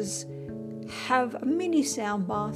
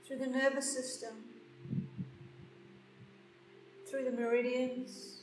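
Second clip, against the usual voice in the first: guided meditation; 8 dB quieter than usual. Acted. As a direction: guide them gently into stillness. Leads a meditation.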